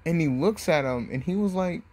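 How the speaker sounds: ordinary delivery, nothing marked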